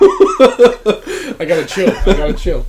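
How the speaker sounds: real laughter breaking through high; giggling while trying to calm down; laughing. Real, not acted. Host shivering from cold laughs at himself, laughing.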